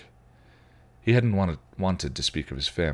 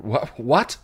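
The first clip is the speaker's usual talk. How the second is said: startled, breathless what; gasped; 7 semitones higher, 5 dB louder than usual. Acted. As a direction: choke out the word in shock. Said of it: "he gasped".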